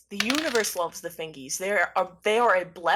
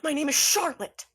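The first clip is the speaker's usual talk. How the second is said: distressed insistence; 7 semitones higher than usual. Acted. Cosmo insists on another identity, distressed.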